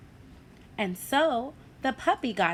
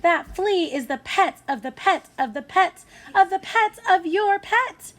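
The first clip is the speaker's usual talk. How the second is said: high, loud, building mother; mother scolding her son, higher and louder; exasperated mother; 10 semitones higher, 5 dB louder than usual. A narrator's reading. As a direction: pile it up, more and more fed up. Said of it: "said the boy's mother".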